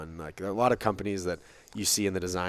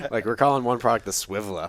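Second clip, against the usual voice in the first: laughing; 4 semitones higher, 9 dB louder than usual. Real, not acted. Laughing about the name.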